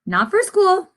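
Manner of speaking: usual speaking voice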